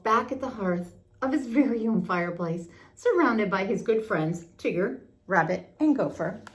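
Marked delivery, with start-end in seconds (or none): none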